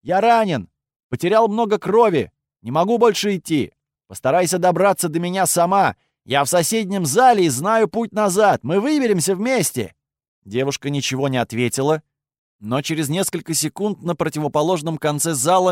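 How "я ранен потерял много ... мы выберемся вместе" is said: man down shout. Acted. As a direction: shout out that you're hurt. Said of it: "выкрикнул".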